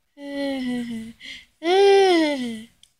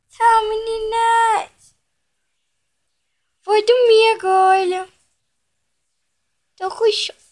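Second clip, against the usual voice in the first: sleepy pet voice; 8 semitones higher than usual. Pretend. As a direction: speak for the pet, drowsy and cute. Voicing the pet saying goodbye.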